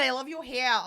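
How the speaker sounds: imitated Long Island accent. Imitating the TV medium admiring hair, Long Island accent. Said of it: "I'm hearing your accents too much".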